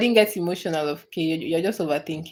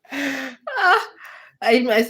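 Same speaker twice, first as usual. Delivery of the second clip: usual speaking voice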